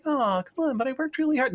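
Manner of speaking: high-pitched mock whine; whiny protest. Language learner begging restaurant staff, mock whiny protest.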